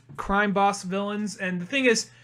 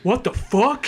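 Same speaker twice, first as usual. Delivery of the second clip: higher, louder shocked reaction; mock stunned disbelief; 5 semitones higher, 5 dB louder, slower than usual. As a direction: react as a stunned crowd, hammed-up. Stunned crowd, retold.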